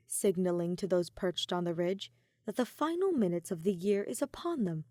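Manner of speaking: plain reading voice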